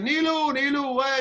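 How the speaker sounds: put-on lifted, affectionate calling of a little girl's name; fond call. Calling out to the child.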